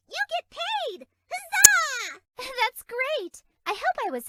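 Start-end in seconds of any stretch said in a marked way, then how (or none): 0.1-2.2 high, loud triumphant cheer. Acted. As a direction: shout it with joy. Fairy celebrates the payout.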